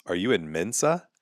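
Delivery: ordinary talk, nothing marked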